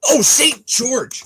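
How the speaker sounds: high, loud, slow exclamation of remembering; drawn-out eureka shout; sudden realization. Real, not acted. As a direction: cry out as it suddenly hits you.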